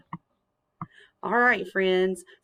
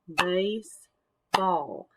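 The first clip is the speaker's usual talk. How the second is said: BASE... BALL, slow separated beats; syllable by syllable; much slower than usual. Shown as a demonstration. Teacher repeating a word for clapping, "Baseball" in two beats. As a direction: break "Baseball" into two with a clear gap. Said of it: "clap out parts of the words".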